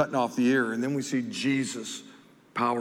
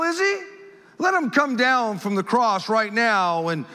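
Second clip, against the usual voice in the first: loud, higher sneering voice; scoffing; 10 semitones higher, 5 dB louder than usual. Acted. Priests scoffing at Jesus on the cross, loud sneer. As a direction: sneer it out with contempt. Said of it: "they scoffed".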